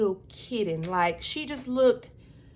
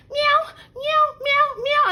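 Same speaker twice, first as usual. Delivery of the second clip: very high, louder kitten meows; imitating kid meowing; 5 dB louder, slower than usual. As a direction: meow like a little boy playing cat. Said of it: "was still like".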